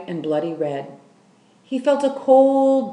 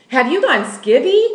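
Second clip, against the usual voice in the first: loud, higher scornful shout; 7 semitones higher, 8 dB louder than usual. Acted. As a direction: yell it at the old woman as if she's lost her mind. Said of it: "shouted Lolly".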